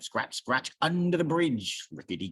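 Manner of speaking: plain reading voice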